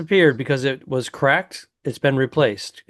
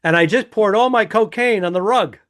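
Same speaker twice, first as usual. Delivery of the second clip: mock outrage; 6 semitones higher, 4 dB louder than usual. Co-host voices him as frantic victim.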